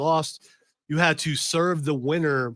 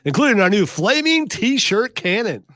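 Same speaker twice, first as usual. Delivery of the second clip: mock infomercial; 5 semitones higher, 10 dB louder than usual. Host riffs a fake Acme ad, booming pitchman voice. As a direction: sell it like a booming TV ad announcer.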